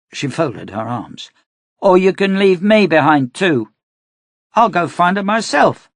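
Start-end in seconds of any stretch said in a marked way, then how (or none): 1.8-5.9 put-on high, loud girl's threat; a little girl daring him; defiant girl. A narrator's reading.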